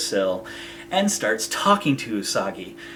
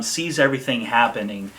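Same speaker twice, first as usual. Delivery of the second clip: normal talking voice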